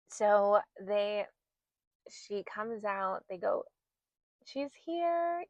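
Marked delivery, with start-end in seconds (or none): 4.4-5.4 excited announcement. Retold or imitated. Staff announcing baby's birth.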